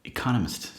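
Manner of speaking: correcting the word Economist; careful retry. Real, not acted. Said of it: "I can't even say that word right".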